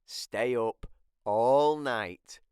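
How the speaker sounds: plain reading voice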